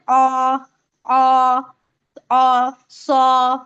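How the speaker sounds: अ held long as a dark "aw", then सौ as "saw"; English "aw" sound. Shown as a demonstration. Teacher borrowing an English vowel as model, long open अ. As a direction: draw out अ like English "aw". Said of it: "English में कैसा करते हैं?".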